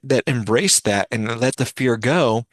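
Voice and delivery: ordinary talk, nothing marked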